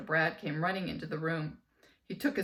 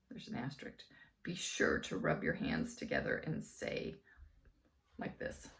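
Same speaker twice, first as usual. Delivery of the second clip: soft whispered note; whispered aside; 6 dB quieter than usual. Real, not acted. Reader whispering a side note to listeners. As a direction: lean in and whisper it.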